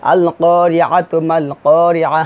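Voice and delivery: slow recitation, করিয়াতু runs into মাল with a sounded "tu"; joined recitation. Shown as a demonstration. Teacher reading two verses together, joined recitation. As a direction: stretch the long vowels, join করিয়াতু to মাল, end করিয়াহ on h. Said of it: "দুটি আয়াত একসাথে পড়ি".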